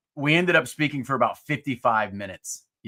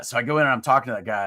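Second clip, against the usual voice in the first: ordinary delivery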